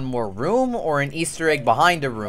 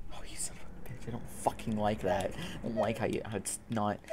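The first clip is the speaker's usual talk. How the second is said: hushed muttering; 4 semitones lower, 12 dB quieter than usual. Real, not acted. Creeped out by horror game.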